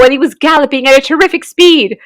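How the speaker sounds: usual speaking voice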